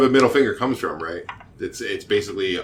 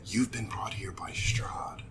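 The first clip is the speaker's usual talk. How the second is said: slow, secretive whisper; hushed warning; 2 semitones lower, 12 dB quieter, slower than usual. Acted. Stranger warning adventurers about Strahd, whispered.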